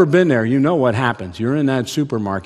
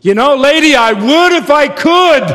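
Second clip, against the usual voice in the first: exasperated dad; 12 dB louder than usual. Retold or imitated. Dad answering the judging lady, high loud exasperated plea. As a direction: plead helplessly with the lady. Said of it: "And I'm thinking".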